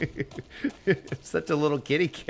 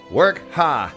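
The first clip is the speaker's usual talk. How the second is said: put-on drunken pirate; 2 semitones higher, 5 dB louder than usual. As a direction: roar it like a tipsy buccaneer.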